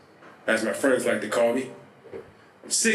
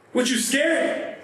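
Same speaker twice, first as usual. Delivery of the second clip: high, forceful commanding yell; goading shout; 13 semitones higher than usual. Acted. Gunman orders his scared partner to grab the gun, goading shout. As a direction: goad a scared accomplice in a much higher voice.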